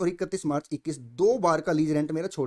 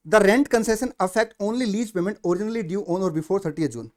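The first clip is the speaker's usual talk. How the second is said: reading the standard's clause out word by word, very slowly; slow formal reading; 6 dB louder, much slower than usual. Acted. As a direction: dictate the text slowly so students can follow. Reading the standard text aloud. (धीरे-धीरे औपचारिक पाठ पढ़ना)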